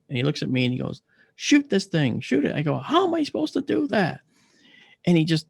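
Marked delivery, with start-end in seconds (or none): 1.4-2.6 high, pressing retold command; urgent order. Kid ordering the patient to shoot, urgent. 2.8-4.2 very high, incredulous wail; exasperated protest. Retold or imitated. Patient told to shoot though he can't move, exasperated.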